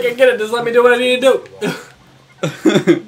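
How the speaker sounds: high, loud protesting yell; mock exasperation. Gamer protesting at Cortana, exasperated yell. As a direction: drag out a fed-up protest. Acts own annoyed reaction.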